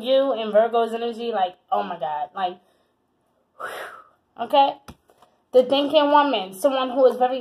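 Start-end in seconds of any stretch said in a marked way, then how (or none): 3.6-4.4 breathy whew. Real, not acted. Reacting to Virgo energy.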